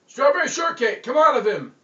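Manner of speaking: booming exorcist cry; hollered. Acted. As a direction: cast out the donut. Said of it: "hollered out the names".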